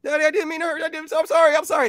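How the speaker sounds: put-on very high, pleading child voice; distraught little boy. Little boy to his hurt daddy, high and desperately sorry. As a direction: apologize frantically in a small kid's voice. Child apologizes for hurting father.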